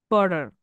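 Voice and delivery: "butter" with the T softened to a quick tap; flapped T. Shown as a demonstration. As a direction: say "butter" as "budder". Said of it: "they say it is a flap T".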